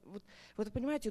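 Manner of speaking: usual speaking voice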